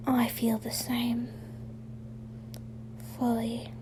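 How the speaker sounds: soft breathy whisper of a confession. A narrator's reading. Ron whispering his feelings to Draco, hushed. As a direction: whisper it, barely voiced, close and tender. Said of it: "Ron whispered".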